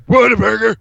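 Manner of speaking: high, put-on exclamation; mock restaurant cry. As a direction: blurt the name in a silly high voice.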